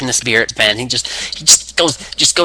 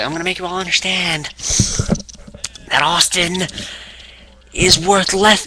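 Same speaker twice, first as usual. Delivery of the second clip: mock angry rant; 7 semitones higher than usual. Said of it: "he's like".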